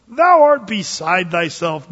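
loud, slow outburst at Paul. Acted. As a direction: shout it at him, accusing. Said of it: "Festus said with a loud voice".

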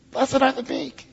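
high, soft squeaky Jesus impression; little squeaky voice. Preacher imitating the movie actor, high and squeaky. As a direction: pitch it up small and squeaky. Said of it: "he had this little high squeaky voice".

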